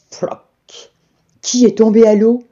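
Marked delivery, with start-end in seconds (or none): 0.1-0.9 whispered splash word; a soft water plop; breathy splash. A narrator's reading. Pancake falls in water.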